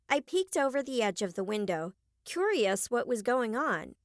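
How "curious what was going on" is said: ordinary delivery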